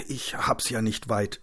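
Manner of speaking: slow, whispery strained voice; forced out. Acted. Beaten man to his rescuer, forcing words out. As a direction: push the words out on little breath. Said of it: "stieß er hervor".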